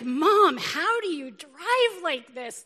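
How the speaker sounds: put-on loud, drawn-out teenage complaint to Mom; mock teenage irritation, slow and emphatic; irritated teenager. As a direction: imitate an exasperated teen son. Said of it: "irritated 'cause they're teenagers".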